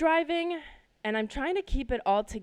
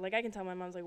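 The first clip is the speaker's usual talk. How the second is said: ordinary talk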